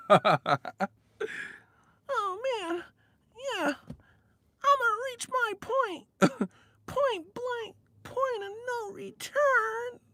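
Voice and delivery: drawn-out falsetto moaning; squeaky media voice. Pretend. Acting out a media guy in bed, high squeaky falsetto. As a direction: squeal it as mock bedroom talk.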